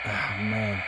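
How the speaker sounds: ordinary talk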